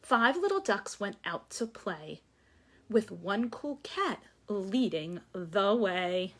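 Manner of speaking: lively sing-song read-aloud; story reading to children. Acted. Teacher reading a picture book to the class. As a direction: read it like story time for little ones.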